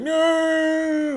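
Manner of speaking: very high, drawn-out protest; hammed-up falsetto no; mock denial. Boyfriend accused of being killer, high mock denial.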